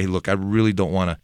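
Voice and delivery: ordinary talk, nothing marked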